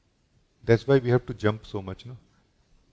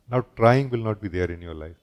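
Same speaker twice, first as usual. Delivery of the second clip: usual speaking voice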